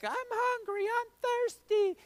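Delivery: put-on high, whining child begging from the back seat; whiny kid voice. Imitating his kids asking on the road trip, high and whiny. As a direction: squeak it out like a bored little kid. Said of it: "When my kids ask me on the road trips".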